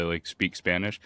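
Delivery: ordinary talk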